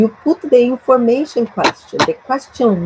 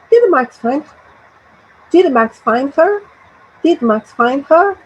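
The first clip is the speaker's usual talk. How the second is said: "her" climbing at the end; rising intonation; 3 semitones higher than usual. Shown as a demonstration. Teacher drilling the question for repetition, rising on "her". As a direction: repeat the question, sending "her" upward. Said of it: "the intonation at the end rises".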